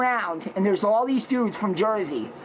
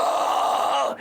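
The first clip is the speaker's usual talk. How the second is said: beast roar; 3 semitones higher than usual. Retold or imitated. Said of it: "I'm going".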